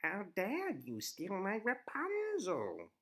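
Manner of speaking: slow, high witch voice; cackling old witch. A narrator's reading. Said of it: "the witch cried".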